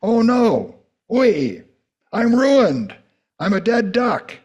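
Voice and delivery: high, loud, frantic dismay; panicked cry. Acted. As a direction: blurt it out in horror. Preacher voices Isaiah's panic.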